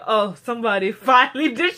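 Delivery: loud, delighted imitation with a laugh; mock gleeful. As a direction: crow it happily, imitating her glee. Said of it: "like, all happy".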